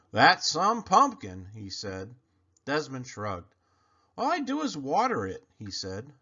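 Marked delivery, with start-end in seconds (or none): 0.1-1.5 higher, louder and slow admiring drawl; impressed expert. Acted. Morris admires his brother's pumpkin, slow and impressed. 4.2-5.5 put-on higher, fast boyish shrug; boy's innocent shrug. Desmond shrugs off his brother's praise, high and quick.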